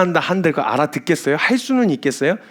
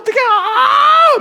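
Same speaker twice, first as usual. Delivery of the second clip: much higher, slow, louder mock shriek; drawn-out panicked shriek; 4 dB louder, much slower than usual. Woman stuck on the slope wailing for help, hammed-up shriek. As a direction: stretch the cry out like a woman in full panic. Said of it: "막 이러면서 거기 서 계시는 거예요".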